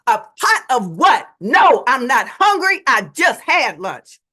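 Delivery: loud exasperated retort, slowing at the end; exasperated exclaim. Retold or imitated. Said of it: "I exclaim".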